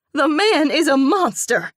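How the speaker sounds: loud high indignant rage; raging. A narrator's reading. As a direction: rage at the man. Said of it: "Ellie raged".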